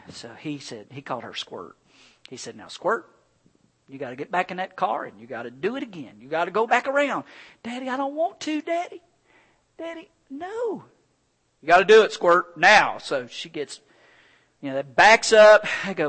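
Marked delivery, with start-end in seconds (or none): 2.5-7.2 lightly voiced dad, urging, rising louder; a hint of a coaxing father; firm dad. Dad coaxing scared daughter. 7.6-10.8 put-on much higher, pleading little-girl voice; scared little girl. Scared daughter pleading with dad. 11.6-13.0 much louder, firm command; loud, stern father; insistent dad. Retold or imitated.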